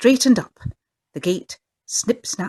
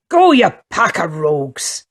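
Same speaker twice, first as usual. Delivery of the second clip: very loud furious roar; 2 semitones lower, 8 dB louder than usual. A narrator's reading. The sailor bursts out at the singing children, furious roar. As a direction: blast the rascals off the doorstep. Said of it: "and he roars".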